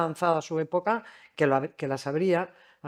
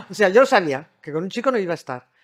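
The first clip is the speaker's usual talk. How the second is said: laughing; 2 semitones higher, 8 dB louder, faster than usual. Real, not acted. Laughing admission.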